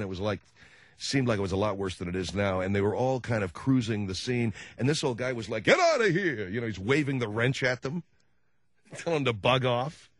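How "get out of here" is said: put-on louder, higher yell of an old man; loud, higher old-man yell at street people. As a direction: chase them off with a shout.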